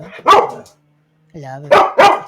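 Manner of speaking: very slow, loud drawn-out love you; drawn-out coo. Real, not acted. Owner crooning love to her dog Cora.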